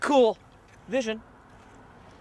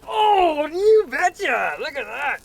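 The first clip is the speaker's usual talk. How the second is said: excited cheer; 9 semitones higher, 7 dB louder than usual. Real, not acted. Excited reveal of cooked meatballs.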